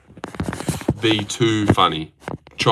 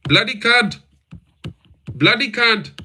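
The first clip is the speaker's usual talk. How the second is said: Indian accent; 9 semitones higher than usual. Shown as a demonstration. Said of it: "That was my attempt".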